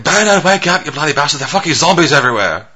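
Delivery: put-on Cockney accent. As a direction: rouse a sleeper in hammed-up Cockney. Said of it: "you know, Cockney and shit".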